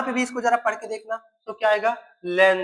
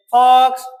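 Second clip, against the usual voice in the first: emphatic demo word; 3 semitones higher, 10 dB louder, slower than usual. Shown as a demonstration. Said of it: "जरा सुना".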